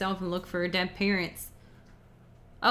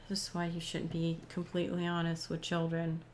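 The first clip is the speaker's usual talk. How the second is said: ordinary delivery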